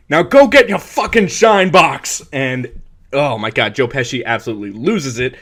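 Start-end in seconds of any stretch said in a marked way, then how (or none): 0.1-2.2 much higher and louder taunt; taunting shout. Retold or imitated. Billy Batts taunts Tommy in the bar, loud. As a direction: sneer it at him. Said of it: "the line from the movie".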